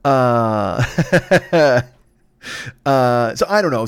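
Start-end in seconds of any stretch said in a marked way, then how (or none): 0.9-1.8 bright burst of laughter; laughing. Real, not acted. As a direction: let a real laugh out. Laughs at the Viking joke.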